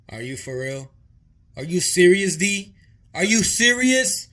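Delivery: loud, high, rising disbelief as his mom; mock shocked yell. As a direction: shout it at him like a horrified mother. Mom shocked at son's beaten face.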